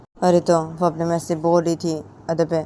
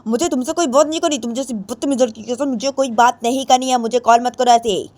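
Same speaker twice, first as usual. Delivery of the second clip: high, loud furious refusal; angry girl refusing to talk; 7 semitones higher, 7 dB louder than usual. A narrator's reading. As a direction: shut her friend down hard. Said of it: "Ritu बोलती".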